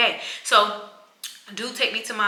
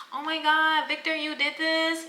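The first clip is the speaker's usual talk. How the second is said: mock delighted surprise; 8 semitones higher, slower than usual. Mock thanks for a surprise cake.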